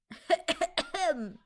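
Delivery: a sharp rough cough. A narrator's reading. Discord coughing to interrupt the conversation, a loud cough. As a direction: clear the throat with a hard cough. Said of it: "said a Discord coughing".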